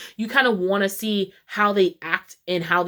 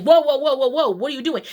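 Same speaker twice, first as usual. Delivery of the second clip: startled, louder and higher cries of whoa; alarmed whoa; 7 semitones higher, 3 dB louder, faster than usual. Retold or imitated. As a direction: rush in to stop him, alarmed. Officers alarmed at hand on gun.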